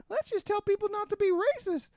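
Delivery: very high-pitched mock voice; mocking naive do-gooder. Mimicking people who only talk about racism, mocking. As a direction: put on a squeaky, clueless idealist.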